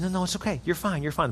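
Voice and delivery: calm her down gently, close to her; reassuring softly. Retold or imitated. Calming a panicking girl.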